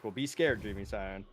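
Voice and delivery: normal talking voice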